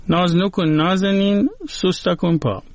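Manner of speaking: ناز نکن نازنین recited with a lifted, rising نازنین; poem recitation. Shown as a demonstration. Host quoting the Gilaki poem to the listener, recited with a rising end. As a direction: recite the line like verse, ending up on نازنین. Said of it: "ادامه شعر اینه که".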